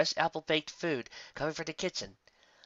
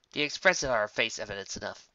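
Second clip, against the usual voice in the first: usual speaking voice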